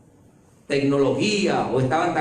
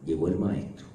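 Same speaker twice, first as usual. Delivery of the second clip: secretive; 6 semitones lower, 6 dB quieter than usual. A narrator's reading. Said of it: "Marta fue en secreto".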